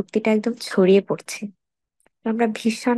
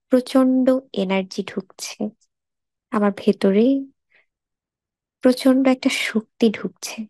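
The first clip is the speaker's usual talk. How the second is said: guided meditation. Acted. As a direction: lead a body scan unhurriedly, phrase by phrase. Leads a body scan meditation.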